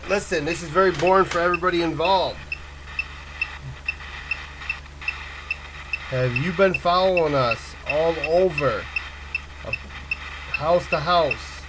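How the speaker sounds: talking to a ghost. Real, not acted. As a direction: confront the unseen presence. Challenging a spirit, louder.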